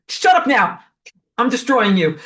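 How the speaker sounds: shutting the punk down hard; mock stern enforcer.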